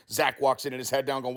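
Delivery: ordinary delivery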